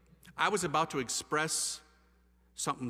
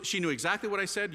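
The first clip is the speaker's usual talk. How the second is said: ordinary talk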